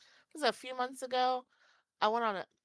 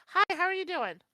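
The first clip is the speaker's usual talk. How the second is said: higher, brighter mock greeting of a passerby; cheery stranger; 8 semitones higher, 5 dB louder than usual. Stranger interrupts her date: imitated chirpy greeting. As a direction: jump up into the stranger's chirpy hello. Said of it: "sudden stranger arrives".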